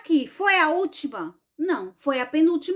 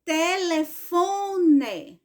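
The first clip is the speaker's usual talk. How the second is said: "Telefone" very slow, long strong FO; stressed syllable FO; 4 semitones higher, much slower than usual. Shown as a demonstration. Teacher confirming the answer, "Telefone" stressed on FO. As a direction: break "Telefone" into syllables, weight on FO. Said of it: "Foi o fon".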